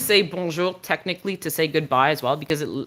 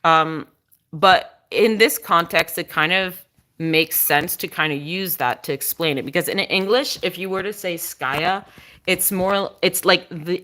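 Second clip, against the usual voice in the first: ordinary talk, nothing marked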